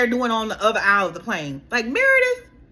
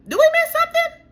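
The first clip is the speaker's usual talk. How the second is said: much higher, louder sassy question; sassy disbelief; 5 dB louder than usual. Real, not acted. Asking a cast member what she missed, high and sassy. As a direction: ask like you can't believe it.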